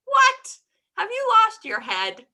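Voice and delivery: high, loud "What?" then an exasperated scold; wife scolding her confused husband; shocked scolding. A narrator's reading. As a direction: cry out at a foolish husband.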